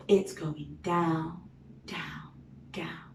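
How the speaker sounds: going down, dropping into a whisper; fading to a whisper. Acted. Arrow sinks, voice sinks to whisper.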